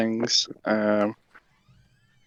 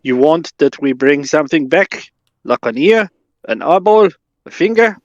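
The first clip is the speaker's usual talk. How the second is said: eager henchman; 5 semitones higher, 4 dB louder than usual. Acted. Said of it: "he's like".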